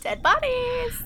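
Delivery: excited echo. Real, not acted. Gleeful echo of dead bodies.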